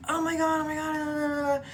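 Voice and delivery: high, frantic wail; acted panic, words dissolving into cries; panicked babble. Girl running from stalker to mom on phone, panicked babble.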